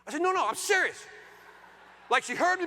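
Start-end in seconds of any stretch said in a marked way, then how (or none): 0.2-1.0 loud, high-pitched protest; emphatic insistence. Retold or imitated. Husband insisting to his wife who isn't listening, exasperated emphasis. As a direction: push hard to be taken seriously.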